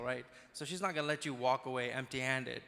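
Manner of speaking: normal talking voice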